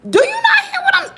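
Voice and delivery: high, loud, disbelieving; can you believe this person; exasperated outburst. Retold or imitated. Outraged at post office asking for packages, high and loud.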